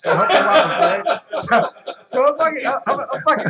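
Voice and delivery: loud laughing words. Real, not acted. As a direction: laugh through the line. Laughing while telling.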